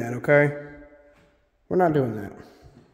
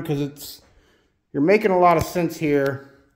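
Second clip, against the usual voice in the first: ordinary delivery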